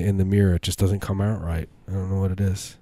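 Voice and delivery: normal talking voice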